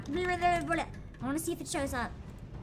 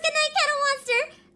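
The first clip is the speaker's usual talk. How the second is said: high, loud mocking sing-song; mocking quote; 11 semitones higher, 6 dB louder than usual. Streamer mimicking her own old joke, high and mocking. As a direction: tease it the way she did. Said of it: "I was like".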